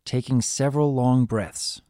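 ordinary delivery, nothing marked

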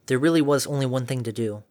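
ordinary delivery, nothing marked

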